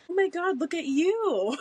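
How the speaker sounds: high patronizing praise; condescending coo. Retold or imitated. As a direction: gush down at someone.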